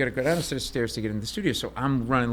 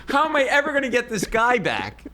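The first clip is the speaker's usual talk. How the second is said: despairing wail; 12 semitones higher than usual. Retold or imitated. Despair after missing the call.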